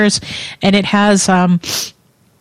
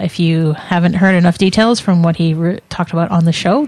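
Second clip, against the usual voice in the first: ordinary delivery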